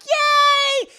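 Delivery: very high, loud drawn-out yay; jubilant cheer. Retold or imitated. Acting the girls celebrating the win, jubilant cheer.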